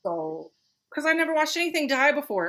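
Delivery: ordinary talk, nothing marked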